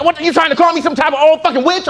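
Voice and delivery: high, fast, outraged snap; woman offended at being called ma'am. Retold or imitated.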